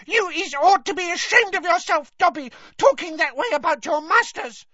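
very high, loud squealing elf voice; squeaky house-elf Winky. Acted. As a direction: scold like an outraged little house-elf.

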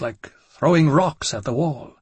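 ordinary delivery

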